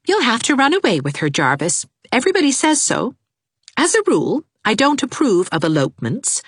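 brisk, sensible friend giving advice; Anne's voice. A narrator's reading.

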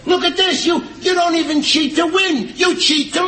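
high, hollered accusation; angry. Acted. Husband accuses his wife of cheating to lose, angry. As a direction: holler it in outraged disbelief.

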